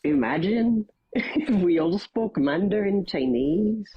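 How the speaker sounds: Belfast lilt, lines rising like questions; a light Belfast IRA man, selling Mandarin lessons; Northern Irish accent. Acted. IRA man urging his cell toward Mandarin, Belfast accent. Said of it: "How's my North Irish accent".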